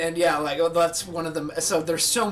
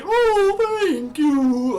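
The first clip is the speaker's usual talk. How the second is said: very high, loud squeal of thanks; squeaky Dory; 13 semitones higher, 9 dB louder, much slower than usual. Retold or imitated. Dory thanking after the whale, in a squealing high voice. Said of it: "after she".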